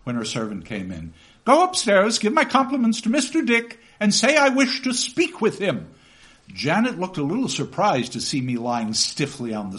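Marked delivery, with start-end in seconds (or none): 1.4-5.9 higher, louder brisk orders; commanding aunt. Acted. Aunt sending Janet for Mister Dick, brisk commanding voice. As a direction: order the servant about. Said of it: "said my aunt".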